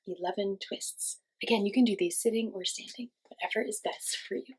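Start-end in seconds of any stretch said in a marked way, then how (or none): none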